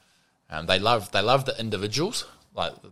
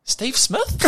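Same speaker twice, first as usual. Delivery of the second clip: much higher, puzzled questioning guess; uncertain fan guess; 4 dB louder than usual. Retold or imitated. Fan mistaking him for Steve Smith, high and unsure. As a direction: guess the name, unsure and hopeful. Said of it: "he went".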